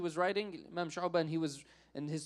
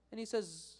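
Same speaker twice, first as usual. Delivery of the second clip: ordinary delivery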